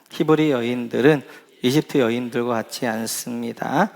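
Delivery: slow, measured Bible reading; slow reading of scripture to the congregation. Acted. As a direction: read the verse aloud together, unhurried. Congregation reads scripture aloud.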